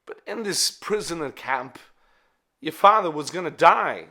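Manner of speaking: put-on solemn war veteran telling a boy, with loud bursts; Pulp Fiction watch speech. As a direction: play a grave old soldier addressing a child. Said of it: "It is the watch scene".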